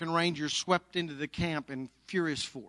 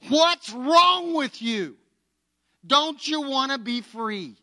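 high, loud, drawn-out challenge; exasperated challenge; 7 semitones higher, 5 dB louder, slower than usual. Retold or imitated. Army Ranger to prisoner refusing to leave, exasperated challenge. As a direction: demand it in raised, incredulous disbelief.

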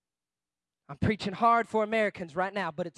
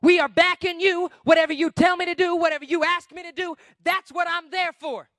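loud, high pledge of loyalty; emphatic, raised preaching voice; rallying support; 5 semitones higher, 7 dB louder than usual. Acted. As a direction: declare full backing with force. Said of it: "be the people that says".

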